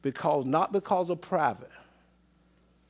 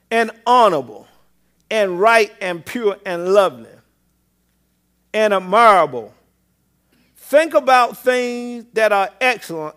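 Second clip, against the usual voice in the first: raised, forceful preacher's Bible reading; scripture reading; 8 semitones higher, 8 dB louder than usual. Acted. Preacher reading Philippians to the congregation, raised and emphatic. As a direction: read scripture out strongly to the congregation.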